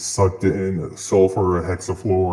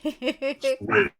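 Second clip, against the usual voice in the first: high squeaky voice; 5 dB quieter than usual. Real, not acted. Voice changer toy.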